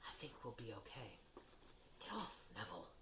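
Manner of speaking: soft, hushed relief; whispered. Acted. Harry whispers to friends behind the locked door. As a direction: keep it under Filch's hearing. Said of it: "Harry whispered".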